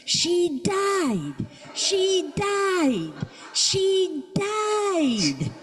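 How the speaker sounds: high, strained scream, breaking at the end. Retold or imitated. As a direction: yell it over and over to a deaf man on the phone. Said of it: "I'm screaming".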